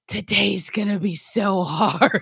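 slow drawn-out moan; hammed-up weary wail; mock despair. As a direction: drag it out like a put-upon complaint. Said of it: "I'm like".

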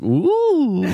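high pretend surprised ooh; fake surprise. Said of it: "I pretend I didn't know".

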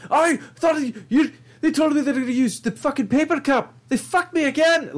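high, hammed-up whiny complaint; squeaky cartoonish betrayed rant; mock betrayed owner. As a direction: whine like a man betrayed yet again. Voicing betrayed Irish coffee owner.

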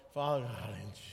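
mock drowsy prayer, very low and faint; sleepy prayer. Tired believer praying in bed, mock sleepy and fading. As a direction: act out nodding off mid-prayer, fading away. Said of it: "You know how we do it?".

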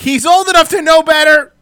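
high, loud self-defence; indignant shout. Real, not acted. Driver defending himself to his co-hosts, indignant shout. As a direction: raise the voice in heated protest.

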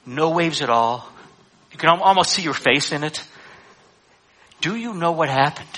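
usual speaking voice

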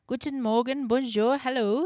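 put-on cheery greeting in a German accent. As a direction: call out a hello with German accent. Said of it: "Herr Gutmann had a heavy German accent".

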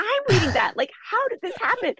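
very high, squealed mock disbelief; imitated high-pitched freak-out. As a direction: re-enact an astonished yelp, pitch way up. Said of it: "like, yelling whenever this happens".

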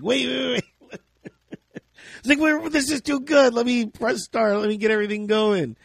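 high, eager "wait, wait"; retelling the on-air riff with high excitement; excited urgency. Retold or imitated. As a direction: stop everything, this is gold. Retold excited realisation.

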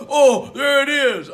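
high, drawn-out mock delight; imitated thrilled surprise; delighted discovery, retold. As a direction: exclaim it high and slow, as if just found. Retold singer finds his falsetto.

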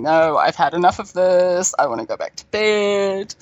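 mock sleepy teenager. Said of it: "have this fifteen-year-old going".

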